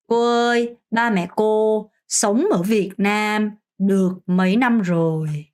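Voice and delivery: slow, clear Vietnamese question; slow model sentence. Shown as a demonstration. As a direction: spell it out slowly as a model. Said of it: "라고 하면 됩니다". (천천히 또박또박 시범 문장)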